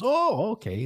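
high-pitched dawning surprise; sudden realization. Retold or imitated. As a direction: let the pitch jump up as it clicks. Chief realizing who's calling.

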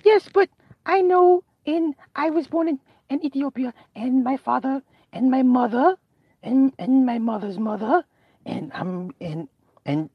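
put-on high, slow and trailing child voice; little girl voice. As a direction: list her family slowly. Girl answers slowly.

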